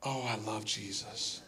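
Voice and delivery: hushed, low, heartfelt confession; tender devotion. Real, not acted. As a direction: say it softly, almost to yourself. Quiet heartfelt devotion after testimony.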